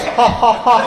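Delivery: high staccato mock laugh; imitated laugh. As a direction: act out a buddy's canned laugh. Jack's laugh in the story.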